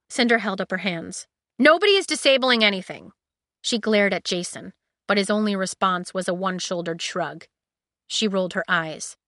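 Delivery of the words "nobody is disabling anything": firm. A narrator's reading. Cinder cutting in firmly.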